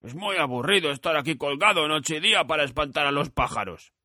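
lightly voiced scarecrow, weary; bored scarecrow. A narrator's reading. Scarecrow complains to Dorothy about hanging there. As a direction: sigh over the endless nights.

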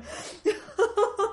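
high, loud burst of laughter. Real, not acted. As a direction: laugh hard after the rant. Laugh after outburst.

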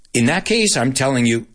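normal talking voice

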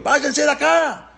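high, stretched-out imitated yell; retold shouting. Paró ordering Moshe's people to leave, high retold shout. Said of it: "Les empezó a gritar".

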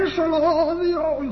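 high, sustained demonic wail; imitated possessed scream. Possessed girl held down during exorcism, in an imitated high scream. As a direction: hold it long and high, a mock scream.

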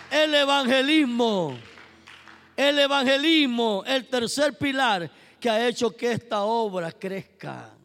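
loud, high proclamation; emphatic preaching. Acted. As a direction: announce the pillar to the church. Preaching at full force.

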